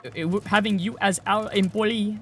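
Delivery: hammed-up scam recruiter reading lines; scam caller. Mocking a scam recruiter calling him, halting and unsure. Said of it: "they sound off".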